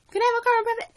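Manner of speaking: put-on very high, whiny kid sister voice; nagging little sister. Little sister keeps pestering for a frappe, whiny. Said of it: "my sister is nagging me the whole time".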